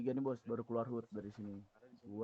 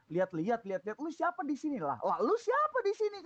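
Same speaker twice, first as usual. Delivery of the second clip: much higher, louder retort; retold heated challenge; 11 semitones higher, 4 dB louder than usual. Storyteller confronting the thug, much higher pitched. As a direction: demand it hotly. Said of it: "kata gua".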